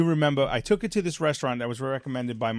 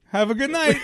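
higher, louder cold goodbye; curt goodbye; 11 semitones higher, 5 dB louder than usual. Retold or imitated. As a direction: dismiss her curtly. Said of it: "I go, I go".

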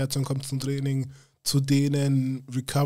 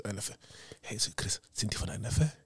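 breathless, hushed disbelief; awed whisper; 4 semitones lower, 8 dB quieter than usual. Retold or imitated. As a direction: whisper it as if you can't trust your eyes. Retold awe at NFL scouts. (ehrfürchtig geflüstert)

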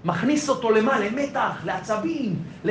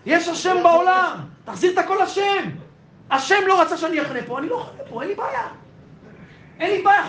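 high, ringing sermon voice; rising passionate preaching; fervent preaching; 8 semitones higher than usual. Acted. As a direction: preach it out with full fervour. Preaching at full force, high.

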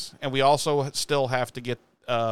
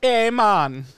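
much higher, loud mock Caribbean greeting; 9 semitones higher, 10 dB louder than usual. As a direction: blurt a cartoonish Caribbean 'hey man'. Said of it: "your stereotyping every Caribbean individual".

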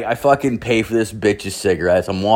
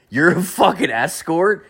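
"You're a fucking escort?" pitched much higher with a rising, knowing question tune; mock-knowing question; 8 semitones higher than usual. Guy to the woman on his couch, knowing rising question. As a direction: lean on "fucking" and lift the whole line up into a sly question. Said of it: "I said it the right way this time".

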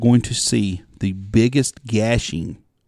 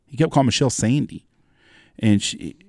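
ordinary talk

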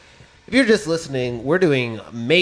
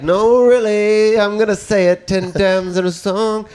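higher, louder, slow protest; loud, higher mock earnest; mock insistence; 6 semitones higher, 5 dB louder, slower than usual. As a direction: insist over the top. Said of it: "But if I was like".

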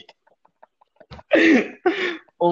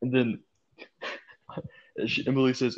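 normal talking voice

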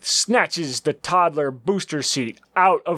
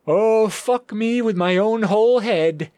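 awed henchman voice; 6 semitones higher than usual. Acted. Henchman reacts in awe.

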